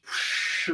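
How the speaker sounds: long hissing whoosh of breath; dragon-like exhale. Retold or imitated. Guy blowing smoke into the man's face, dragon-like exhale. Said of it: "lets it out like a fucking dragon".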